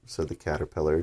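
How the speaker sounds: plain reading voice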